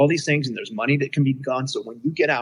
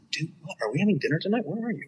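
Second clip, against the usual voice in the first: higher, puzzled questions; confused; 5 semitones higher, 6 dB quieter, faster than usual. Retold or imitated. Caught off guard by a friend's call, confused. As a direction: sound lost and baffled. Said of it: "I'm like".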